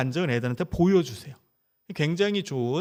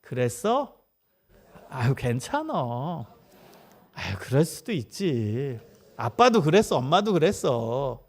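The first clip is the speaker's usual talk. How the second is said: soothing acceptance; 4 semitones lower, slower than usual. Shown as a demonstration. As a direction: model gentle acceptance for the parents to repeat. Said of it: "여러분 한번 따라해 보시겠어요?".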